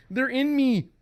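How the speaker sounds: high, loud acted anguish; possessed husband. Possessed husband begs wife, high loud anguish.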